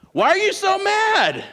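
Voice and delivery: high, loud, slow mock outrage; hammed-up indignant protest; mock indignation. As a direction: act offended that others are mad. Mock indignant driver after own mistake.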